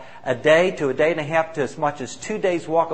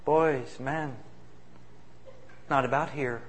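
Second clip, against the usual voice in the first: earnest and soft; 9 dB quieter than usual. Retold or imitated. Elder's earnest closing line retold.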